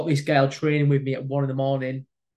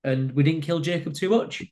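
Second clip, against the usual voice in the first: normal talking voice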